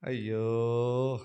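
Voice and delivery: long, slow "ayyo"; drawn-out groan of dismay. Acted. Preacher despairs over his people's petty prayers, long mock groan. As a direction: groan it out as one stretched lament.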